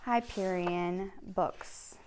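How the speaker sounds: usual speaking voice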